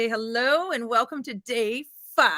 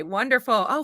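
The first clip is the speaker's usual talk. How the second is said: normal talking voice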